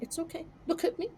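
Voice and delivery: soft consoling. Retold or imitated. Consoling a friend after a narrow loss, softly. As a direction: talk him down softly.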